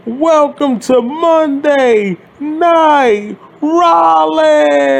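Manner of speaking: Seth Rollins catchphrase. Retold or imitated. Ranting fan mocking a copied catchphrase, high and slow. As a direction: ham up the entrance greeting slowly. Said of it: "Seth Rollins is like".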